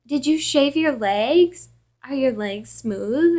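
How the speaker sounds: slow, drawn-out mock leering; slow creepy questioning, hammed up; creepy caller imitation.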